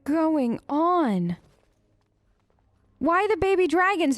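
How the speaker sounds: normal talking voice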